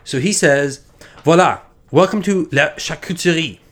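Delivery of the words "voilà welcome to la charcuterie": gun seller greeting customers in a hammed-up French accent; playful mock-French host welcome; bad French accent. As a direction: put on a clumsy French accent, a bit higher. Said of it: "my French is so bad".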